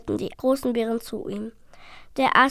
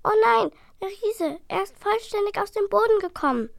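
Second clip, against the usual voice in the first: high, loud little dragon in panic; alarmed cry; 10 semitones higher, 4 dB louder than usual. A narrator's reading. Little dragon to his brother, panicked and loud. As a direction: cry out as the giant rises. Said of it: "Balthasar schrie ihn an".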